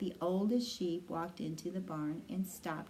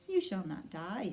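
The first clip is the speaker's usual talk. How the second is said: ordinary delivery, nothing marked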